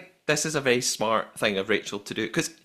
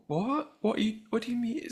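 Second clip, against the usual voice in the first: high, soft bewildered questions; imitating a flustered contestant, higher and softer; baffled Ross; 9 semitones higher, 5 dB quieter than usual. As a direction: sound completely lost.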